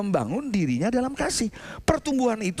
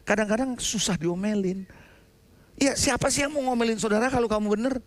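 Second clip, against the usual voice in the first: usual speaking voice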